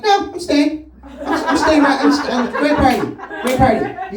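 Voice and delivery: loud insistence. Acted. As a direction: insist loudly that you are staying. Acted insistence on staying, Norwegian goodbye.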